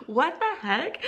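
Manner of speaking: high-pitched retold disbelief; shocked. Teacher reacting to a student's rude word, shocked. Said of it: "I was shocked".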